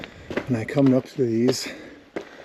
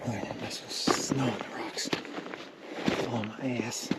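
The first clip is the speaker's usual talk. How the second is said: hushed whisper while sneaking up; 11 dB quieter than usual. Real, not acted. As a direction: breathe the words, barely voiced. Sneaking up on a cave.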